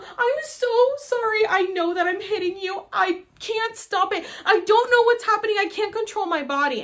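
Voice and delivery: high, loud desperate pleading; panicked apology. Retold or imitated. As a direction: beg forgiveness in a panic. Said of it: "And I go".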